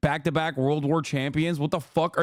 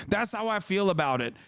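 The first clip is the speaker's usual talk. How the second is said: usual speaking voice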